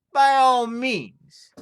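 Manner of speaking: grand, cheeky invitation up the chimney; mock-polite shout. Lad mocks the voice.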